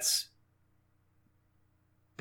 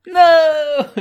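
very high, loud drawn-out no; dismayed wail; 9 dB louder than usual. Real, not acted. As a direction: cry out against the death. Player hears the character dies.

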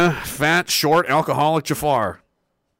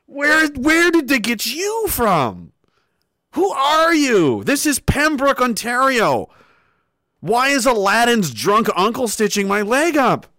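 incredulous; 8 semitones higher, slower than usual. Retold or imitated. Incredulous at doctor.

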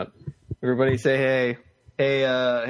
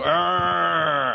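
higher, drawn-out creature growl; Bigfoot sound; 5 semitones higher than usual. Shown as a demonstration. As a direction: demonstrate a Bigfoot roar for a child. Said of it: "make a Bigfoot sound".

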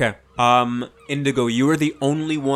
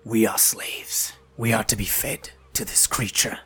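frightened goblin whisper; 4 dB quieter than usual. Acted. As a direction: whisper it as if the guards might hear. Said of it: "and he says".

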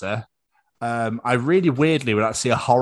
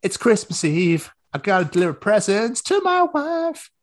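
higher hammed-up action hero; mock hero voice; 11 semitones higher, slower than usual. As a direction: play the hero for laughs, higher. Acting out Die Hard plot.

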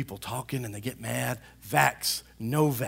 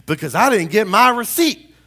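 loud indignant complaint; acted petty anger; 3 semitones higher, 11 dB louder than usual. Customer mad over a missing receipt, ranting. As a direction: rant as if freaking out. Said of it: "getting mad about things and freaking out".

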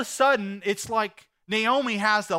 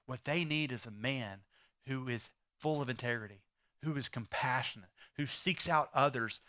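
much lower and much softer; tender appeal; 6 semitones lower, 7 dB quieter than usual. Real, not acted. Pastor urging fathers gently, hushed and earnest. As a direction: drop to a hushed earnest plea.

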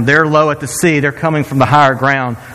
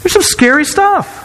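emphatic reassurance. Retold or imitated. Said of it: "I go".

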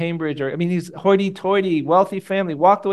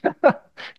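short breathy laugh; 5 dB louder than usual. Real, not acted. Laughs recalling crying in dorm.

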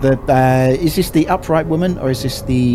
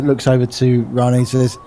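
ordinary talk, nothing marked

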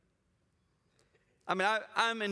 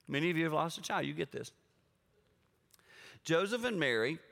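normal talking voice

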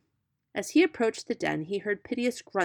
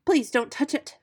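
higher, louder plea in distress; mild piggy distress; distressed cry; 7 semitones higher, 5 dB louder than usual. A narrator's reading. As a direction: beg the fox, frightened. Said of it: "cried Whitey in great distress".

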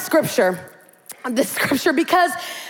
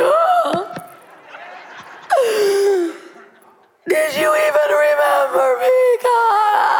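mock wail to God; 9 semitones higher, slower than usual. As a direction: cry out to God in mock betrayal. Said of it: "You're like".

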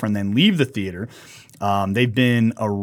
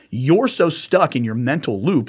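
ordinary delivery

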